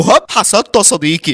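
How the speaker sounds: usual speaking voice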